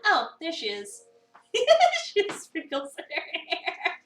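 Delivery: usual speaking voice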